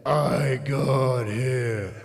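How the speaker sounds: slow, lightly deepened menacing voice; a hint of a huge lineman looming over you; deep-voiced lineman. Big lineman Dwayne threatening the small kid, lightly deep. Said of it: "The deepest voice I ever heard".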